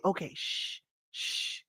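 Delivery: quick breathy hush to someone nearby; shushing whisper. Real, not acted. Hushing someone off camera during her chat, whispered. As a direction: hush them with an unvoiced whisper.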